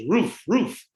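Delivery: roof, roof as a high mock bark; dog bark. Joke's talking dog answers the bartender with a mock bark. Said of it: "the dog goes".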